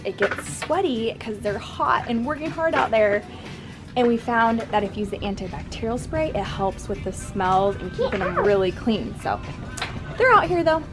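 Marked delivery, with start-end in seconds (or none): none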